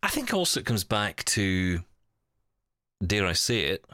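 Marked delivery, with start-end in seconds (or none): none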